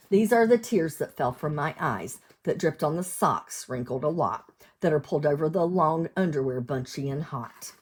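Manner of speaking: sing-song storybook read; lilting picture-book reading; reading to children. Acted. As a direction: read the rhyme out to little ones. Cumulative rhyme read aloud.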